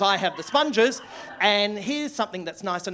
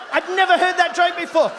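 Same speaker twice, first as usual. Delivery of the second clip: loud and high, laughing through it; laughing shout; 10 semitones higher, 9 dB louder than usual. Retold or imitated. Comic reliving his joy at the kid's punchline, laughing shout. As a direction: bellow it out in delight.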